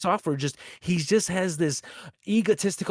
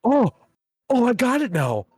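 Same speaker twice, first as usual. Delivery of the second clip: higher, hammed-up fake discovery; mock sudden realization; 6 semitones higher, 4 dB louder than usual. Imitating the streamer for his co-host, hammed-up. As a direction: play the streamer faking an aha.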